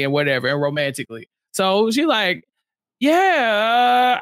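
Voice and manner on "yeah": long drawn-out, hedging yeah; imitated reluctant drawl; mock reluctant. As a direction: stretch it out, imitating someone hedging. Acting out her reluctant reply.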